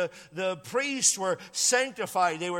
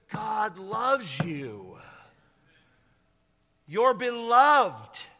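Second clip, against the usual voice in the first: drawn out, each word weighted, beloved swelling louder; slow emphatic declaration; 3 semitones higher, 4 dB louder, much slower than usual. Real, not acted. Pastor to congregation, slow weighty assurance. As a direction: lay it down slowly so it sinks in. Pastor stressing God's love.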